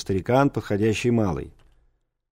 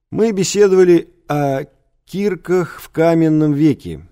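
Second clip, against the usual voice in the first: pupil reciting; 6 semitones higher, 5 dB louder than usual. A narrator's reading. Pupil answers the teacher in class.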